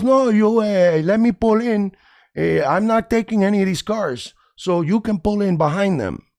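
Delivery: high, insistent mock Cuban accent. Stranger bargaining over parking, high mock Cuban accent. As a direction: push the Cuban impression way up in pitch. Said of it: "Fucking Cubans".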